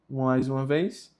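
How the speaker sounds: normal talking voice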